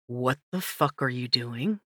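low, soft and gentle curse; soothing tone meant for a horse, angry words. A narrator's reading. Dustin to the narrator near the horse, soft and gentle. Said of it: "Dustin's tone was soft and gentle".

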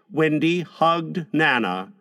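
plain reading voice

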